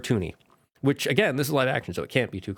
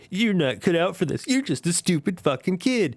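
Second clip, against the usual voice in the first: a general's contempt, pitched higher; lightly voiced general belittling a kid; sneering general; 6 semitones higher than usual. General mocking Zuko in the war room, in a mild sneering voice.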